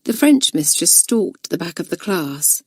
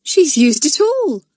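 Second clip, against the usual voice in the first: put-on high, horrified gasp of a girl; 9 semitones higher, 4 dB louder than usual. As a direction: sound appalled at the empty pot. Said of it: "sounding horrified".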